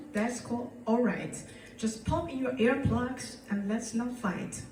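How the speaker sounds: comic poem performance; playful recited poem over music; poem recital. Acted. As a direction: perform it like a cabaret number.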